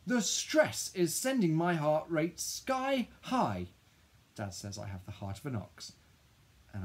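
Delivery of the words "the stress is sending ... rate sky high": high, drawn-out loud wail; angry wail. A narrator's reading. Child telling Dad of the stress, high wail. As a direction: wail it slowly, stretched and high. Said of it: "I wail".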